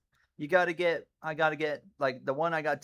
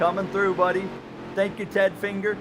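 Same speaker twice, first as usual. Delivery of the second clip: cheeky call-out; 6 semitones higher, 4 dB louder than usual. Real, not acted. Passing a rival car.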